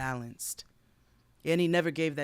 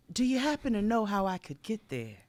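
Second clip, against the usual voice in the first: higher-pitched voice for Annie, politely asking; Annie's eager question; 8 semitones higher, 3 dB louder than usual. A narrator's reading. Annie asks the young soldier the way to the school, higher-pitched polite question. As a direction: read Annie's line in a raised, inquiring voice.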